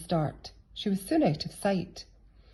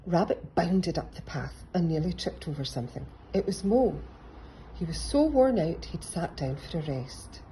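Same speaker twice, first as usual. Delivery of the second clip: ordinary delivery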